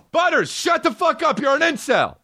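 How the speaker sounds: very high-pitched scolding; exasperated yell. Real, not acted. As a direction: yell at the noisy dog in exasperation. Yelling at his noisy dog.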